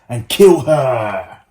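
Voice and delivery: storyteller booming the queen's wicked order; dramatic. A narrator's reading. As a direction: lean hard into the threat, slowly. Narrating queen's murderous order.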